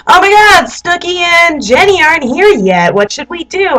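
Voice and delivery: mock dismay. Roommates wondering where their friends are, mock dismay. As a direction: imitate a roommate's fretting, pitch swooping high.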